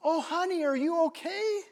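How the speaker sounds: high, sweet voice of concern; high-pitched, solicitous question; concerned woman. Retold or imitated. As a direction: voice a kind stranger fussing over someone. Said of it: "she immediately says".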